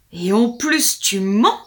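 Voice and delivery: loud, indignant accusation; angry lord. A narrator's reading. Lord accuses the man of lying, angry. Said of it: "en colère".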